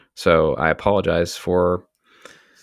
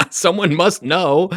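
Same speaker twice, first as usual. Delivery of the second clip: high-pitched, drawn-out plea after a laugh; laughing exasperation; 11 semitones higher, 3 dB louder, slower than usual. Real, not acted. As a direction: protest slowly through real laughter, voice lifted high. Laughing disbelief at vague answer.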